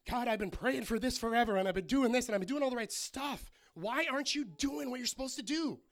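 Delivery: loud, high, exasperated mock prayer; mad at God. Believer demanding answers from God, acted mad. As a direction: act out a fed-up complaint, pushed loud. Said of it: "we get mad at God".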